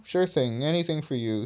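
ordinary delivery

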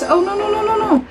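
high, flustered protest; awkward joy. Real, not acted. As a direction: squeal it out in happy disbelief. Overjoyed fan protesting too short.

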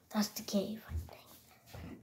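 very soft, secretive whisper; hushed whisper. Pretend. Rescuer spots the cave, hushed whisper to companions. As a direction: whisper it while sneaking.

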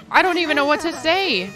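lost for words; stunned disbelief. Real, not acted. Stunned by demonic moment.

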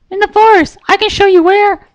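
very high, bright cartoon pony voice; high squeaky cheerful pony girl; Pinkie Pie voice. A narrator's reading. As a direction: chirp it like a giddy little pony.

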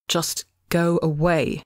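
slow, worn-out plea, only lightly voiced; a god too tired to fight, asking them to go; exhausted. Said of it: "George said exhaustedly".

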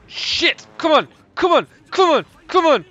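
high, loud repeated yells of come on; frustrated yell. Real, not acted. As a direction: shout it out in frustration at the game. Frustrated shouting at game.

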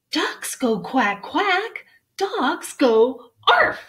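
slow, loud lesson with high animal noises; instructing mother. Acted. Mother dog correcting George on animal sounds, emphatic. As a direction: spell it out for the pup.

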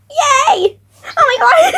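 high excited laugh; squealing laughter. Real, not acted. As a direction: shriek with joy and laugh. Playing on the swing.

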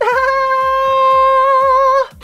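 very high, loud sustained note; long high wail. Acted. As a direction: hold one piercing high cry. Teacher's long high cry.

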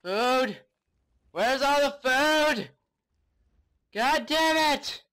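high, loud, slow exasperated yell; frustrated shout. Real, not acted. Frustrated search for food.

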